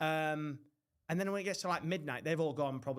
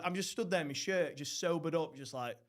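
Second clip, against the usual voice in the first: ordinary delivery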